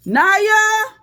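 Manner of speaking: loud, high call across the house; calling the dog. Real, not acted. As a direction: yell the dog's name to call her in. Calling her dog into the room.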